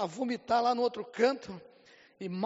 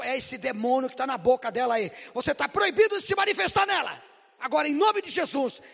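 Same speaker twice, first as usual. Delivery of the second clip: much higher and louder, forbidding the demon; commanding rebuke; 5 semitones higher, 7 dB louder than usual. Retold or imitated. Pastor commands the demon in the woman, loud higher rebuke. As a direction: cast it out with full authority.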